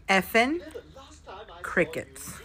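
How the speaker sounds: usual speaking voice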